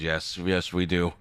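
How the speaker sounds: ordinary delivery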